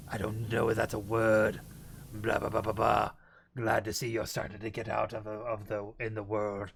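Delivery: low put-on demon, stumbling over words; mock-solemn low demon. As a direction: keep the deep demon voice while improvising. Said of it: "of the demon voice".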